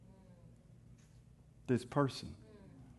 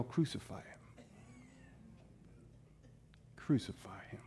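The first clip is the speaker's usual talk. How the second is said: muted mock yell; 3 semitones higher, 11 dB quieter than usual. Crowd turning five days later, yell imitated softly. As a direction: voice the yell under your breath. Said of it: "they can yell".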